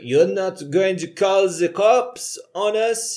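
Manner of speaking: hammed-up French-American dub voice; fake French-American accent. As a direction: put on a phony French accent, drawing the question out. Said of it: "dubbed in with the fakest French-American accent".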